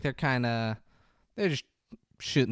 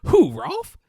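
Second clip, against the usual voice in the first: much higher, louder incredulous question; hammed-up disbelief; 11 dB louder than usual. Mocking his answer about Rolf, high loud incredulous. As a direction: overplay the mock surprise. Exaggerated second take.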